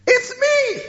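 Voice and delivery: high desperate shout across the gulf; shouted call. Acted. Rich man shouting across the gulf to Abraham, high and desperate. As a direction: yell to be noticed, pleading. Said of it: "So he shouted".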